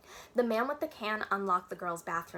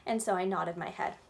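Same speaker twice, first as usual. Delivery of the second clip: plain reading voice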